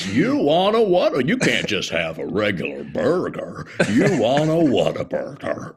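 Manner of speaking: jowly burger-ad pitchman, swooping pitch. Retold or imitated. Imitating the old Whataburger spokesman selling burgers, very jowly voice. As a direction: imitate a big jowly commercial announcer. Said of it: "like, very jowly".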